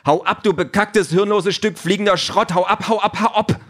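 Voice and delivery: very high, frantic yelling; furious screaming. A narrator's reading. Peter screams at the drone to leave, enraged. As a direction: scream insults at the top of the range.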